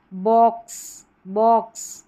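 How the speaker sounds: बॉक्स with a half k before s, stressed; half k sound. Shown as a demonstration. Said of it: "आधा क का sound रहेगा". (बॉक्स में आधा क)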